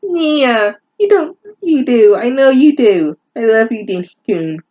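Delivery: high, hammed-up lovesick boyfriend; mock Denmark voice. Reader voicing Denmark's reply to Reichu, high mock lovesick voice. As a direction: squeak it out like a cheesy romance hero.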